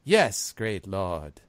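slow, deferential underling; a hint of a servile follower; lightly voiced Envon. Envon answering the great lord, slow and deferential. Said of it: "And Envon says".

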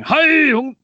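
put-on aborted, high, loud try at a Russian accent; a failed first go at a Russian accent; bad Russian accent. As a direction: start a Russian accent and give up on it. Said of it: "that wasn't a very good Russian accent".

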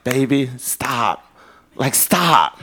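soft, slow, low plea to stop; hushed, patient correction; gentle divine admonishment. Retold or imitated. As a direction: tenderly rein someone in, unhurried. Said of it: "I know God is like".

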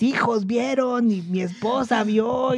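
imitating anxious older coworker. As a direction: explain the problem fretfully, like a panicked señor. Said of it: "tengo que imitar su voz". (voz de señor preocupado, más aguda y lenta)

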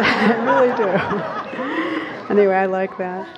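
slow words through real laughter; laughing. Real, not acted. Amused teacher admitting to weird emails, laughing.

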